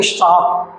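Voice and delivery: "सांप" with the tongue touching the teeth on स; dental sa. Shown as a demonstration. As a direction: demonstrate a drawn-out dental s in "सांप". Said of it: "जिह्वा होती है वो आपके दांतों से टच".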